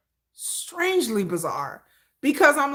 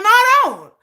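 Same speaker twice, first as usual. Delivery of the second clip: "No, I don't" higher and louder, firm; loud refusal; 10 semitones higher, 9 dB louder than usual. Real, not acted. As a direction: snap back firmly. Refusing to understand fans.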